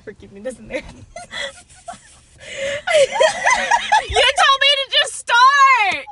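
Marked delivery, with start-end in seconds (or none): 0.9-6.0 laughing. Real, not acted. Caught out, both laughing.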